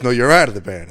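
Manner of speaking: loud, heated comeback; imitating a shouted retort; retold screaming match. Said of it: "screaming match".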